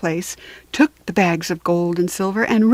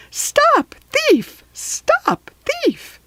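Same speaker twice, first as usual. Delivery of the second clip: much higher, shrill magic harp cry; much slower than usual. A narrator's reading. Enchanted harp calling for help as Jack grabs it. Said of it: "the enchanted harp called out loudly".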